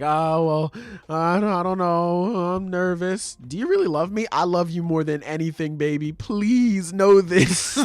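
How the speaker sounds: high, eager, gushing love confession; nervous lovestruck voice. Retold or imitated. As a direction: swoon it out like a smitten boy. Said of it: "He's just like".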